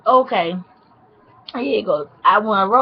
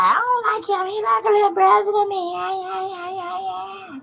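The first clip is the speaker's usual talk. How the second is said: high, whiny put-on voice; high mocking imitation; mocking best friend; 8 semitones higher, 5 dB quieter than usual. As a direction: mimic her denial in a high squeal, trailing into softer yammering.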